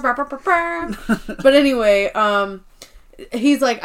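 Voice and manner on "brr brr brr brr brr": buzzing lips like a ska trumpet; trumpet imitation. Friend mimicking Ryan's ska band trumpet, playful buzzing. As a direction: toot out a mock trumpet riff. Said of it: "He's the trumpet player".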